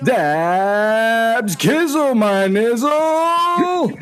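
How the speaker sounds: booming ring announcer, stretching the name; slow, drawn-out hype intro; lucha libre announcer. Acted. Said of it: "did that sound better".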